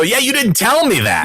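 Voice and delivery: high-pitched retold protest; mock-aggrieved, voice jumping up; indignant complaint. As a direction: throw it back at him in protest.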